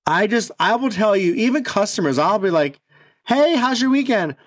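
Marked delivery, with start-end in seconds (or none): 3.3-4.3 bright high customer-service greeting; friendly shop greeting, voice lifted high; cheery greeting. Retold or imitated. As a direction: greet a customer sweetly, pitched up.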